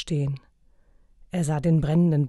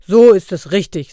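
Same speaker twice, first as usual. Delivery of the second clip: loud hearty approval; emphatic praise; 3 semitones higher, 14 dB louder than usual. A narrator's reading. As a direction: boom the praise. Santa praises, surprising.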